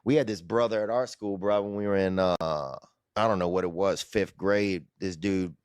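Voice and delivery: ordinary delivery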